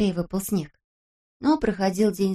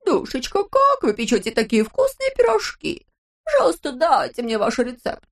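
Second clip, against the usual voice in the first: much higher squeaky fine lady; 6 semitones higher, 3 dB louder than usual. Acted. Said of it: "говорил писклявым голосом". (писклявым голосом)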